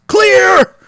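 sudden high yell; shouted clear. Pretend. Host mock-shocking a patient, loud shouted command. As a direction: bark it like the paddles are charged.

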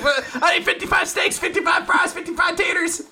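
high shouted comic list; mock frantic ordering. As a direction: yell out an absurd order. Parody of frantic food order.

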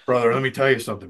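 quick, lightly voiced wrestler catchphrase; a hint of wrestler talk; Hulk Hogan. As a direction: toss out a casual wrestler-style brother. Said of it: "kind of talked like Hogan".

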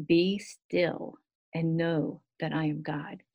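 slow, unhurried recitation of the verse; slow recited verse. Shown as a demonstration. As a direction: let each word settle, slowly. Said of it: "So be still and know that I am God".

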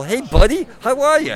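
high, loud and chummy; friendly, kid-level greeting. Acted. Batman greeting a kid on their level, high and friendly. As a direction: greet a little kid brightly, pitched right up. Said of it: "if you go on their level".

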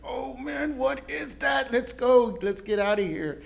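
a startled swimmer crying out in mock fright; mock scared shout. Pastor retelling seaweed scare, mock frightened shout.

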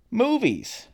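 high, bright announcement of the topic; announcing. Real, not acted. Vlogger announcing the next topic, high and excited. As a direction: call out the next segment high. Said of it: "announces new segment".

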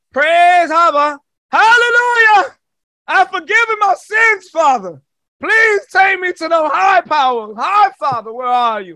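high, loud, slow praising shouts; fervent worshipper. Retold or imitated. Humbled souls begging the High Father, high loud fervent praise. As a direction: cry out to heaven in rapture.

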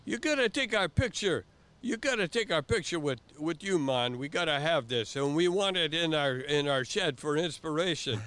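high, loud excited island voices; Jamaican beach guys. Retold or imitated. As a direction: beg for the picture with delight. Beach guys beg for picture.

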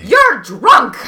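loud, playful protest; laughing exclamation. Acted. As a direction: toss it back with a laugh. Voicing herself laughing at him.

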